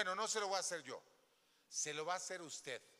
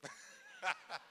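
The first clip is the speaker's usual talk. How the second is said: laugh; 3 semitones higher than usual. Real, not acted. Laugh at own joke.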